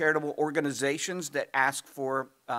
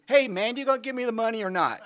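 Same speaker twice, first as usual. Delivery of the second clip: higher, fast, pushy demand; pushy panhandler; 8 semitones higher, 6 dB louder, faster than usual. Retold or imitated. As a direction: fire it off like an impatient hustler. Panhandler demands money, retold.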